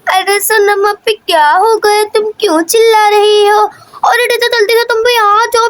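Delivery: put-on high storybook child voice, building from puzzled to frightened; panicky little girl. (डरी हुई बच्ची की ऊँची आवाज़)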